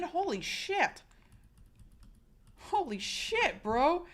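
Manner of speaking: awed. Real, not acted. Shocked reaction.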